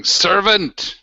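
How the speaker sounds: loud call for a servant. Acted. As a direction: bellow for the servant down the hall. Calling out for a servant.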